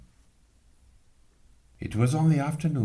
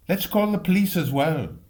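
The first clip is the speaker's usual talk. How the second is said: put-on eager boy; 9 semitones higher, 5 dB louder than usual. Excited boy Jim.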